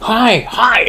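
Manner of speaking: high mock-Japanese chorus; Japanese pilots' cry. Pilots answering their admiral, high mock-Japanese cry. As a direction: chime in like obedient recruits. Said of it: "all these guys are going".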